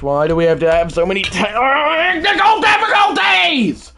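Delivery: high, loud outburst building to a scream; real fed-up shriek; irritated scream. Real, not acted. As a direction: scream in exasperation at the tech. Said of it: "I'm very irritated right now".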